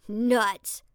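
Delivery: loud, rude dismissal. A narrator's reading. Calvin scoffs at Charles's claim, rude. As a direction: throw it out rudely and loudly. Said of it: "Calvin said rudely".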